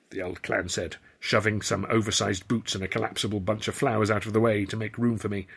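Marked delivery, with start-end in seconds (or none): none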